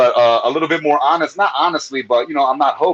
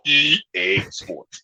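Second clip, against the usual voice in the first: low, very slow game tagline; whole-body announcer voice; 4 semitones lower, 3 dB quieter, much slower than usual. Shown as a demonstration. Comic coaching the host on the tagline, deep slow announcer. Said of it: "do it with your whole body".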